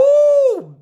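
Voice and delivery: a long, very high "ooh" of delight; ecstatic preacher cry. Acted. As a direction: swoop way up on the "ooh" as the spirit hits. Preacher's ecstatic cry before a point.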